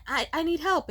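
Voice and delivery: high, loud, stammered plea; pleading child. Retold or imitated. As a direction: imitate a kid insisting on help. Child pleads with busy mother.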